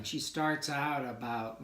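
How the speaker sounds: normal talking voice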